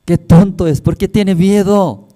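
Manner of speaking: voz de conejo más aguda y fuerte, burlándose; ríete del otro conejo miedoso con voz aguda; conejo burlón. Acted. (el conejo de adentro se burla del miedoso, voz aguda y burlona)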